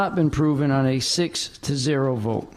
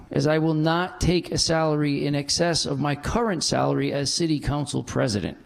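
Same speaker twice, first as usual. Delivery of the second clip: usual speaking voice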